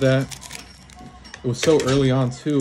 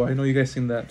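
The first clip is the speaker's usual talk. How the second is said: normal talking voice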